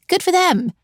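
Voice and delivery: Good jumps way up, then falls; mock congratulations, dripping sarcasm; sarcastic. Sarcastic jab at young entrepreneurs.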